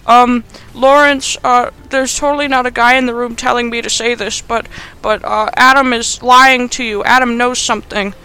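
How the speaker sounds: mock coerced wife. Wife on the phone under orders, hammed-up hesitant. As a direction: stall unconvincingly, as if fed the words. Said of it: "then the wife is like".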